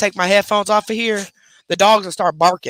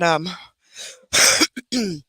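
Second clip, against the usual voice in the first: normal talking voice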